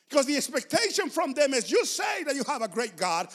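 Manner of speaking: ordinary talk, nothing marked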